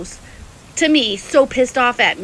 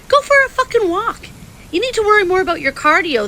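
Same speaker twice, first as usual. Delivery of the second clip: angry outburst; 8 semitones higher than usual. Real, not acted. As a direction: pitch jumps way up on a furious jab. Angry rant at online rival.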